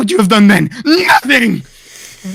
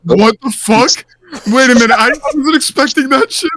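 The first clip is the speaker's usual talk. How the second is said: shocked outburst; 3 semitones higher than usual. Real, not acted. Shocked reaction.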